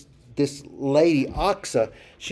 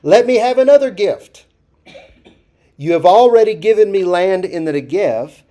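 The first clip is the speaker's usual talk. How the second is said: bold eager request; 5 semitones higher, 8 dB louder than usual. Retold or imitated. Achsah boldly asks her father.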